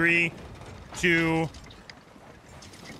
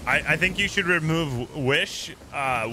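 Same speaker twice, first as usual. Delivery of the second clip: usual speaking voice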